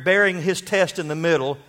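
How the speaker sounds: usual speaking voice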